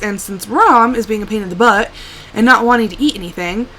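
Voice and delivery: usual speaking voice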